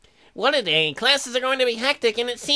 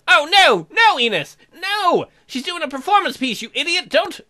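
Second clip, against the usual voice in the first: higher and louder, shouting a warning; alarmed yell; 7 semitones higher, 5 dB louder than usual. Real, not acted. Player shouting at his character not to kiss her, alarmed yell. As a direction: yell at him to stop.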